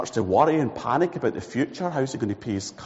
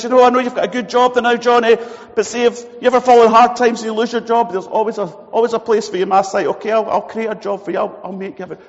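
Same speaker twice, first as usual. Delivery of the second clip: higher, louder, eager promise of work; eager foreman mate; 7 semitones higher, 6 dB louder, faster than usual. Acted. Foreman mate talking up a job offer to Johnny, loud and eager. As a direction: brag that he'll always find him a job.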